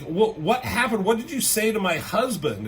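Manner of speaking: high, shocked demand; imitating a wife confronting the culprit; alarmed confrontation. Wife demanding what was said to her husband, high and alarmed. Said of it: "Gwen Stacy's like".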